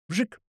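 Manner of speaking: quick high, soft zip; rocket whoosh imitation. A narrator's reading. Narrator voices a grenade launch, high zip. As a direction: mimic a projectile zipping away.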